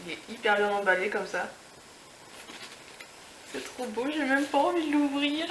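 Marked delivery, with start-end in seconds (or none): 4.1-5.5 high, laughing through the words; delighted giggle; laughing with delight at a gift; giggling. Real, not acted. Giggling over the pretty packaging.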